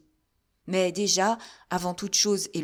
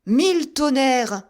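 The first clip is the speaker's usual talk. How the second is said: loud, slow, higher exclamation; startled oath; 6 semitones higher, 9 dB louder, slower than usual. A narrator's reading. Musketeer exclaiming on hearing a voice, loud. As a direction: burst out in shock. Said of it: "s'écria D'Artagnan".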